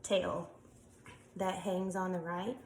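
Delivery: ordinary talk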